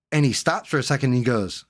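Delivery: ordinary talk, nothing marked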